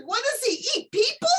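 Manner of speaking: hammed-up alarmed squeal; mock alarm. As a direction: play up fear of the snake. Retold alarmed question about snake.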